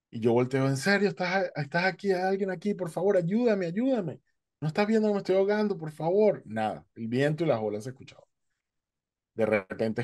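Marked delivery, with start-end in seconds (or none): 0.7-6.4 high, frantic begging for help; pitched-up panicked plea; desperate pleading. Retold or imitated. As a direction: plead for your life to the unseen voice.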